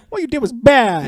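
put-on high, loud childish scolding; little kid voice. Kids' letter to bin Laden.

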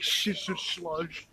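slow, soft, lisped Shit to slosh with sh sounds; lisped repeat. Shown as a demonstration. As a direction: show the lisp on Shit to slosh, slowly and quietly. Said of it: "It gets worse with cuss words".

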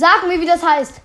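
loud insistent demand. Real, not acted. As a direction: shout it, demanding an answer. Demanding answer, repeated.

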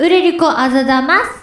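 put-on high cute anime girl voice; a playful little anime girl; Anya-style anime voice. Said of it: "アーニャ風お願いします。".

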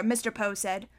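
plain reading voice

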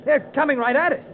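high, panicked warning; alarmed. Acted. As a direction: cry out as the ship looms. Ship bearing down on them.

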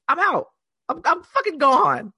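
high, loud "I'm out"; fed-up exit. Real, not acted. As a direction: storm off in mock outrage. Fed up, quitting over tickets.

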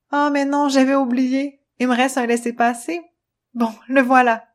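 put-on a touch brighter curious girl voice; Luna voice. Luna's voice in the story.

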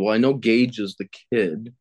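ordinary delivery

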